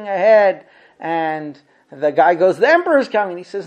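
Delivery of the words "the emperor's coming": high and loud, sure the emperor is here; all excited. Retold or imitated. Apostate sure the emperor is arriving, loud excited high call. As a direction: shout it with eager excitement. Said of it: "every time the guy gets all excited".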